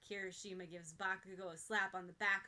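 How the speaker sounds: ordinary delivery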